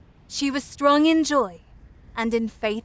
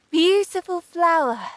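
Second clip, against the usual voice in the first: higher, delighted exclamation; admiring delight; 5 semitones higher, 4 dB louder than usual. A narrator's reading. Sunbeams greet the opened flower, delighted and admiring.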